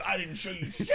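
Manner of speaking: high-pitched mock protest; retold indignant dad. Dad denying blame to his son in the school office, mock indignant. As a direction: act out a dad snapping in denial.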